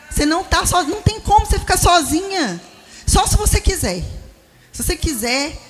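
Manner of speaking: fervent exhortation. Acted. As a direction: lift the congregation up, insist they're not alone. Preacher rising to exhort women. (pregando com fervor, mais agudo e mais alto)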